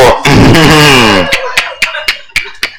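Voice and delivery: helpless laugh; real laughing fit. Real, not acted. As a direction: break up laughing at your own story. Breaks up at own joke.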